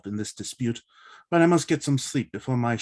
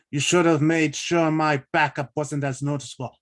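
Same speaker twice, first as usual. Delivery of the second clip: ordinary talk, nothing marked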